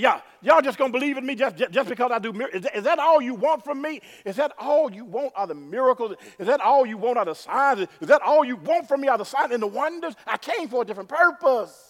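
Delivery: loud, high indignant rebuke; indignant Jesus. Acted. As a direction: scold the crowd for wanting signs. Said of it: "He gets indignant".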